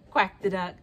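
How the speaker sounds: ordinary delivery, nothing marked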